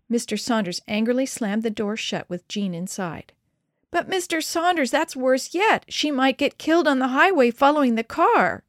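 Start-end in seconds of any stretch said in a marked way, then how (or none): 3.9-8.6 high, distraught pleading that gets louder; upset child's wail; tearful protest. A narrator's reading. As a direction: protest as if crying, pitched way up. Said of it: "Jean was crying now".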